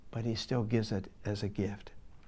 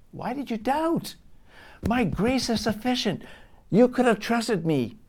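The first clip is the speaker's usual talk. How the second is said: much higher, pressing rebuke; rebuking Jesus; 11 semitones higher, 5 dB louder than usual. Retold or imitated. Jesus rebuking Peter in the boat, high and insistent. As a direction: voice Jesus scolding with care. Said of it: "he rebukes him and says".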